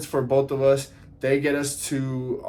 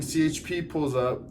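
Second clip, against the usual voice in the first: normal talking voice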